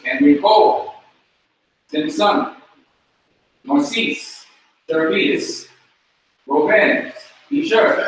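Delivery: Simpson, Narcisse, Jervis, Robin each stressed on the final syllable; last-syllable stress. Shown as a demonstration. Teacher running through the class names, stress on last syllable. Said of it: "The accent comes on the last syllable".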